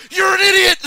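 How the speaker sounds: high, full-volume yelled insult; angry Bernie. Retold or imitated. Retelling Bernie's outburst at Gavin, furious yelling. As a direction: explode in a mock-furious shout. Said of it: "And Bernie goes".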